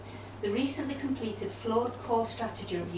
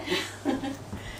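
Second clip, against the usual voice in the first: short laugh; 6 semitones higher than usual. Real, not acted. Speaker laughs at "road to nowhere.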